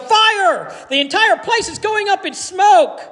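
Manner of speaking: high, frantic cry of alarm; panicked yell. A narrator's reading. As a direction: shout it like the building is burning. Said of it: "in a panicked voice, yelled".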